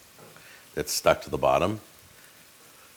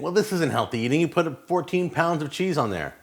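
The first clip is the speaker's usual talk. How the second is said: mock viewer voice; 8 semitones higher than usual. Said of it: "So a lot of you are saying".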